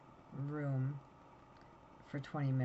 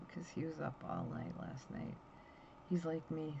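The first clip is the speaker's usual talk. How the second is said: ordinary talk, nothing marked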